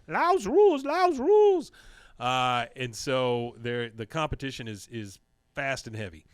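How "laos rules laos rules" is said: high, loud chanting cheer; high-pitched, boastful mock chant; Khan. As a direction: cheer for your country in a put-on voice. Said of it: "and Khan's out there".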